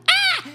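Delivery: sudden very high involuntary yelp; a startled squeak as the hand shoots up. Retold or imitated. Kid blurting out when her hand goes up, high yelp.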